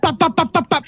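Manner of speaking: high clipped pop pop pop like gunfire; mock drive-by gunfire; imitated gunshots. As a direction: spit out gunshot sounds.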